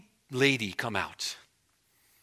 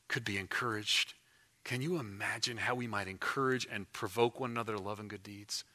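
hushed sermon appeal; 3 semitones lower, 5 dB quieter than usual. Acted. Pastor softly urging the church to join the mission. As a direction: draw them in gently, almost confiding. Soft closing appeal to congregation.

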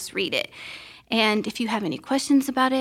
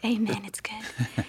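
soft whispered aside; whispered amen; 5 dB quieter, slower than usual. Real, not acted. Wife whispers amen to the hosts, softly whispered. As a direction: breathe the amen under your voice.

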